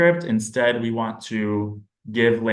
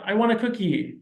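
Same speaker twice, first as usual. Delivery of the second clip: put-on high child's voice; child's request; 8 semitones higher than usual. SLP voicing a child asking for a cookie, pitched high. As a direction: ask like a little kid.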